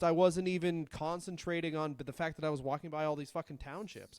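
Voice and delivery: usual speaking voice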